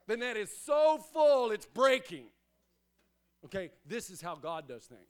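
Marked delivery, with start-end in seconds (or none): 0.0-2.2 The net is so full, it's breaking, called out much louder; excited climax. Acted. Preacher reaching the miracle, calling out loudly. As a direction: burst out with the wonder of it.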